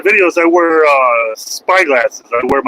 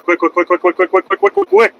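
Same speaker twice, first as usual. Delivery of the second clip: quick words climbing much higher; mocking chant of quick, climbing higher; rapid-fire repeat; 3 semitones higher than usual. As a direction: rattle them off, rising up to a squeak. Mocking rushed viewers.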